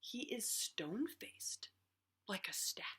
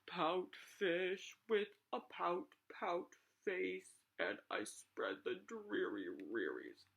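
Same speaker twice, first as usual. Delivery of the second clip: droop every word like a sulking fish; gloomy pout fish; 2 semitones lower than usual. Acted. Sad fish sings his refrain.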